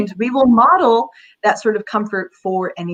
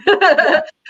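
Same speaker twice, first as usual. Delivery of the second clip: bright laugh; 8 semitones higher, 5 dB louder than usual. Real, not acted. Laughs after advice.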